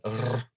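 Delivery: "R" as a tongue trill; rolled R. Shown as a demonstration. Teacher reminds students of the hard sound, "R" as a rolled trill. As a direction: roll "R" on the tongue tip, held as a trill. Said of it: "they would roll their R's like that".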